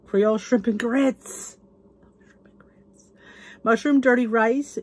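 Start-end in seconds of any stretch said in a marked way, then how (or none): 0.1-1.6 gasp, then slow delighted reading; excited announcement. Real, not acted. Gasps at dish, announces it. 2.2-3.0 barely audible, reverent whisper; awed whisper. Real, not acted. Repeats dish in awe.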